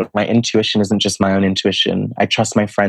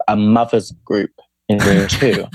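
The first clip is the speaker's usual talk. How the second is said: ordinary talk, nothing marked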